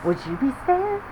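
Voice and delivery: usual speaking voice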